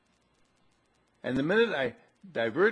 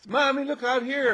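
put-on high, loud child's call; acted boy yelling from outside; little boy calling Mom; 13 semitones higher, 7 dB louder than usual. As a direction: holler for Mommy like an excited kid. Voicing little boy calling mother.

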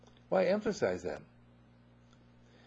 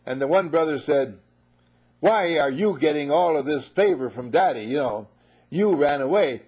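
pizzazz storytelling; 3 semitones higher, 7 dB louder than usual. Acted. Preacher retelling the parable with pizzazz, loud. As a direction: tell the parable with pizzazz, full volume. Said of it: "put a little pizzazz in it".

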